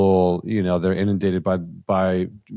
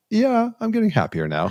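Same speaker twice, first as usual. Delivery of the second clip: sweet reply. Retold or imitated. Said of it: "And I was like".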